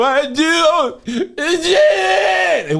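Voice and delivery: slow, high, crying wail; drawn-out comic cry; mock wailing. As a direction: ham up the sob. Recalling crying at the show.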